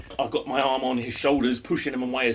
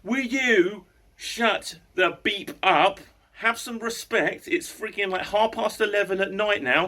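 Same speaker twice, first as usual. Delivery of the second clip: pitched-up angry lecture, dragging the words out; raised voice; 7 semitones higher than usual. Retold or imitated. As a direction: scold the noisy neighbour from the doorway. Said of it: "with a little bit of a raised voice".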